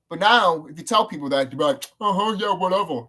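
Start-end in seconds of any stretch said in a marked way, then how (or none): 2.0-3.1 imitated brush-off; mock dismissive. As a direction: play a sceptic waving it away. Mimics people brushing him off.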